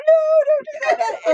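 high-pitched imitation of a wailing daughter; mock crying. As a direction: plead in a high, half-laughing fake cry. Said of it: "starts crying harder".

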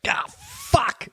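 high unvoiced gasp of pain. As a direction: gasp it out, hurting. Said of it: "I kind of was like".

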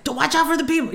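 mock panicky dad; nervous Nelly. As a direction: imitate a jumpy backseat driver. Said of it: "just nervous Nelly".

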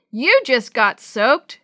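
loud, drawn-out taunt; slow, loud, amused call; gleeful teasing. A narrator's reading. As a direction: crow it at a soaked friend. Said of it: "Chris laughed".

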